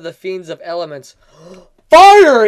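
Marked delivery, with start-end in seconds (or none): 1.9-2.5 gasp, then yell Fire! high and loud; shock-cry the word Fire; dramatic high yelled Fire; gasping shout. Acted.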